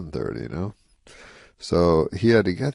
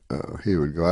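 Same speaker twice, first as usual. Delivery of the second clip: normal talking voice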